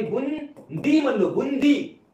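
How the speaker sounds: Boondi slowly stretched into two syllables; emphatic clarification. Real, not acted. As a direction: draw out boondi so nobody can mishear it. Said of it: "दुसरं काय ऐकतोय तुम्हाला मी?".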